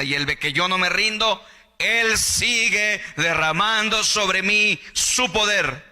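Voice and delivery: high, loud, slow climax of the sermon; fervent preaching. Acted. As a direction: drive the promise home with full force. Preaching at full force.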